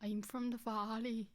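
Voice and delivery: put-on slow, quiet Welsh accent; timid Welsh valleys voice. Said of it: "Bonus points for best Welsh accent".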